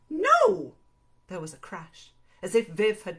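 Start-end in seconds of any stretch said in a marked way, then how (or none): 0.1-0.7 high, loud outburst; angry refusal. Acted. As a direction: cut him off with a shouted refusal. Viv refuses angrily in acted quarrel.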